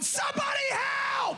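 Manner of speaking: high, drawn-out screaming for help; panicked husband. Retold or imitated. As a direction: shriek for help like a trapped man. Husband trapped upstairs in fire.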